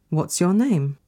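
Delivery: ordinary talk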